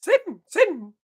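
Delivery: very high cartoon mouse retry; Mickey Mouse voice. Retrying the first line for viewers in a high Mickey voice. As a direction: reach up high for the mouse squeak. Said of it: "I can't really quite hit it".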